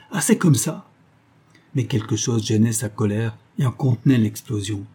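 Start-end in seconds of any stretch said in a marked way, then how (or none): 0.1-0.9 higher-pitched angry outburst; raised, defiant protest in rage; defiant cry. A narrator's reading. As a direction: cry it again with rising defiance. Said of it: "en toisant James d'un air de défi".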